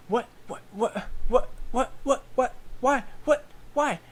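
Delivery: high, sputtering what-what-what; flustered gnome. Retold or imitated. Flustered gnome runs back.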